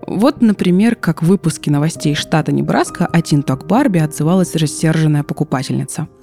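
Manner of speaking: ordinary talk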